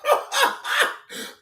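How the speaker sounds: a breathy squeal of a laugh; high airy cackle; high wheezing laugh. Real, not acted. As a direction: burst out laughing, almost silent. Laughing at own story.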